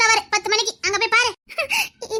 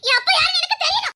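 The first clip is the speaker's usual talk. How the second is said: high, shouted insistence; desperate demand; 5 semitones higher than usual. Acted. Girl demanding to know her father, desperate shout. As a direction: cry out the demand.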